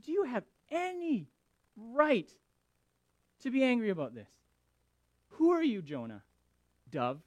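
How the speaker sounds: high, loud mock indignation; sassy God. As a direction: put him in his place with exaggerated sass. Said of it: "I don't know if God would be that sassy".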